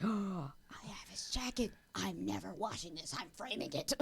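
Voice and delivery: hushed, reverent fan whisper; soft breathless fan whisper; awed whisper. Retold or imitated. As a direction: breathe it like holding a treasure.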